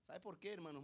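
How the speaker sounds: usual speaking voice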